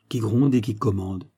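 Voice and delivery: usual speaking voice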